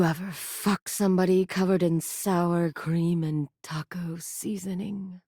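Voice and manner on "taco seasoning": breathy suggestive whisper. Acted. Teasing the player with a dirty question, whisper. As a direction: drop to a whisper on the last words.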